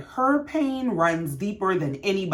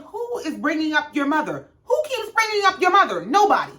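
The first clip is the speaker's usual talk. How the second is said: exasperated retort; 8 semitones higher than usual. Retold or imitated. Cherie exasperated in fight.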